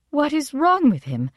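high, baffled question; bewildered. A narrator's reading. Mother asking her daughter about the father's departure, bewildered. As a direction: ask it pitched right up, at a loss.

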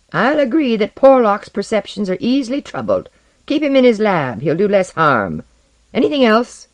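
disagreeable, scornful character voice; unpleasant voice. A narrator's reading. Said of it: "said Osden's unpleasant voice".

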